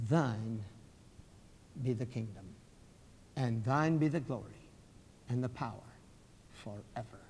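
low, hushed doxology; prayerful recitation. Acted. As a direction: recite it reverently, fading away.

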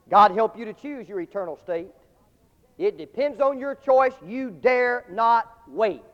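loud, very slow warning; urgent appeal. Acted. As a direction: drag out the warning with weight.

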